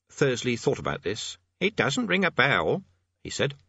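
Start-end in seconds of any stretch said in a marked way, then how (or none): 1.6-2.8 high, slow puzzled voice; pondering amateur demonologist. Acted. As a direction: muse over it in a high voice. Thursley puzzling over a name.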